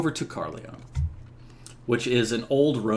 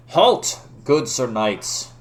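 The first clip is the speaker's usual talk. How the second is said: knight's commanding call; shouted challenge; 2 semitones higher, 5 dB louder, much slower than usual. Acted. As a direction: order riders to stop from inside a helmet. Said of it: "he shouts from within his helmet".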